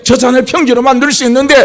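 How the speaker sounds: ordinary delivery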